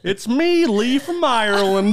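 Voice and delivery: high, loud, drawn-out fake Irish; mock Irish accent. Impersonates the Irish listener.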